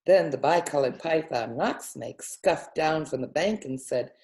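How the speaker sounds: ordinary delivery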